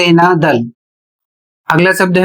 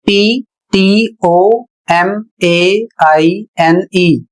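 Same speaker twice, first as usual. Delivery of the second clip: पीटीओएमएआईएनई spelled letter by letter, very slow; spelled out slowly; much slower than usual. Shown as a demonstration. Teacher spelling the word slowly for students. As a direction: read each letter slowly. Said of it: "अगला शब्द है".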